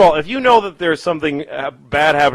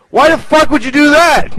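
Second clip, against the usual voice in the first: high, raised-voice exasperation; God's appalled outburst; 11 semitones higher, 4 dB louder than usual. Acted. God berating Abraham over the killing, appalled outburst. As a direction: yell it as an incredulous scolding.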